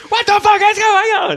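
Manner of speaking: high, loud shout; mock bewildered scream. Imitating a parent amid the chaos at home, bewildered screaming. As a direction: yell in frantic confusion.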